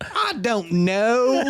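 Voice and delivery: slow, high Southern drawl on "I don't know"; Southern accent. Retold or imitated. Mom imitating her silly kids in a slow Southern drawl. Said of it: "this Southern accent".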